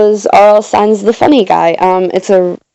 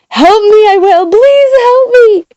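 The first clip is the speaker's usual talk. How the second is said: very high, wailing cry for help; frantic frightened scream; 4 dB louder than usual. A narrator's reading. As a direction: scream for rescue, drawing out the last words. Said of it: "frightened, a frantic, frightened scream".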